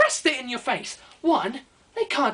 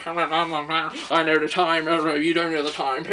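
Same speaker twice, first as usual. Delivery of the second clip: low, very slow, gloating; taunting collector, low and slow; smug watch collector; 6 semitones lower, slower than usual. Acted. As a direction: drag it out lower like a smug know-it-all. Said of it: "walks around going".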